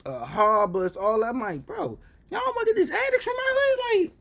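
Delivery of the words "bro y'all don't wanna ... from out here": squealed, incredulous plea in a much higher voice; high-pitched incredulity. Retold or imitated. Reacting to addicts downtown, high-pitched incredulity. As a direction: voice leaps way up in mock disbelief.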